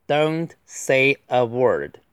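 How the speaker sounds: very slow, each word apart; deliberate, spaced-out delivery; slow model reading. Shown as a demonstration. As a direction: read it out word by word for learners. Slow model reading, then faster repeat.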